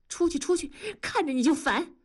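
high, sharp order to get out; irritated dismissal. A narrator's reading. Wife grabbing the quilt from her husband, irritated dismissal. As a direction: drive him off in exasperation. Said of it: "文丽一把抢过被子说".

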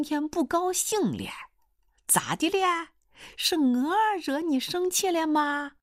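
high, drawn-out wheedling suitor; cheeky would-be son-in-law. Acted. Suitor greets his hoped-for mother-in-law, cheeky and wheedling. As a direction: sweet-talk her in a high, slow lilt.

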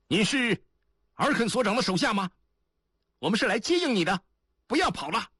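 high, quick shouted questioning; calling out. A narrator's reading. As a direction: call across to someone hiding. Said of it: "亚丽坤喊话". (高声急促地喊话)

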